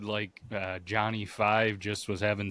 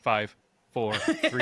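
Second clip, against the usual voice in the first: ordinary talk, nothing marked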